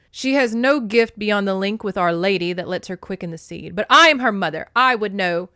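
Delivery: loud, emphatic motherly certainty; insistent Demeter. A narrator's reading. Demeter insists a mother would know, forceful. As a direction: insist forcefully, building on the claim.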